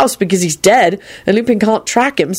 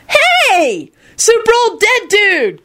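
blissful screech; much slower than usual. A narrator's reading. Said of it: "Kenny screeched blissfully".

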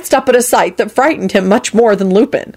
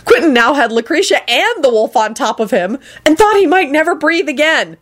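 ordinary delivery, nothing marked

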